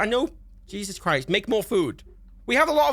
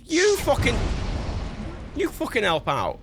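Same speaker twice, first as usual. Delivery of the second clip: angry cursing; 6 semitones higher than usual. Real, not acted. Cursing at game advisor.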